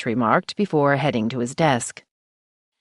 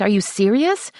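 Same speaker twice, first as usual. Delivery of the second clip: shocked disbelief; 6 semitones higher than usual. Acted. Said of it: "I practically shrieked".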